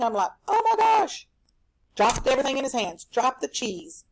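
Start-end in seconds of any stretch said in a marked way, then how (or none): 0.5-1.3 very high, louder gasp of shock; teacher showing her own shock. Retold or imitated. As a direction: squeal it in disbelief. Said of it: "when I see something shocking".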